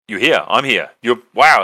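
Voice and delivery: you can't believe he's really standing there; excited surprise. Retold or imitated. Meeting online friend Pete at his door, loud delighted surprise.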